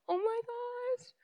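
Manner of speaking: high, soft, drawn-out gasp of shock; stunned disbelief. Retold or imitated. Tenants reacting to the passport demand, stunned. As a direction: stretch it out slowly, high and hushed.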